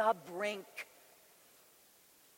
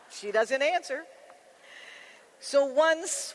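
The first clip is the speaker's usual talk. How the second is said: ordinary talk, nothing marked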